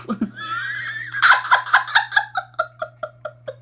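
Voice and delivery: a real, high burst of cackling laughter; shrieking with laughter. Real, not acted. Loud laughing fit.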